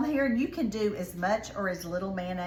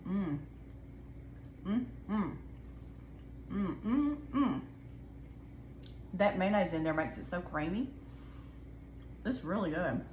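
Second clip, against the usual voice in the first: tasting while talking; 6 dB quieter than usual. Real, not acted. Tasting the pear salad.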